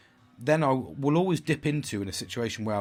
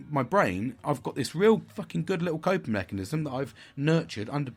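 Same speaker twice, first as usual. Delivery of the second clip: usual speaking voice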